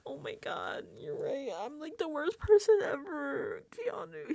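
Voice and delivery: high, self-pitying mock whimper; little guy routine. [name] grovelling to Keanu, mock pitiful little guy. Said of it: "He's doing his, "I'm just a little guy" routine".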